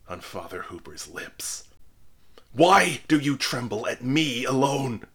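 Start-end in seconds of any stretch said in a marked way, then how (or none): 2.5-5.1 higher, louder anguished cry; dying minister crying out to pale spectators; cried. A narrator's reading. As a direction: hurl the question at the circle. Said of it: "cried he".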